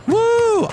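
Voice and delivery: loud, very high "woo"; celebratory whoop. Pretend. Host glad the magazine is gone, loud high whoop.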